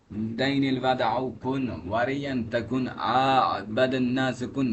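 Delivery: ordinary delivery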